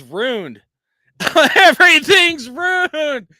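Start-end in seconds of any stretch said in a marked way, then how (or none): 1.5-3.3 slow, high, loud wail through a laugh; mock-despairing shout. Real, not acted. Vlogger wailing to his viewers over a ruined night, a slow hammed-up shout. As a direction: ham up the tragedy at full volume.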